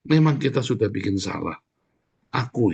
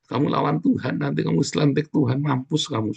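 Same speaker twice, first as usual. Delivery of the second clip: ordinary delivery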